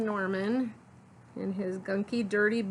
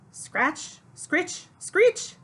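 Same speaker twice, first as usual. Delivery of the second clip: each word higher, ending loud and high; mounting screechy noise; 10 semitones higher, 7 dB louder, much slower than usual. Acted. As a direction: build the scratching up to a screech. Window noise grows in the story.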